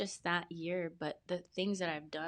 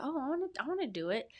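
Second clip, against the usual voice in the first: quick, much higher eager burst; excited; 8 semitones higher, faster than usual. Retold or imitated. Seeing her friend's fitness challenge, excited and eager. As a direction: jump at the idea, thrilled. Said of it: "I got excited again".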